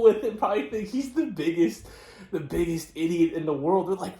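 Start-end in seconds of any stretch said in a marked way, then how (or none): none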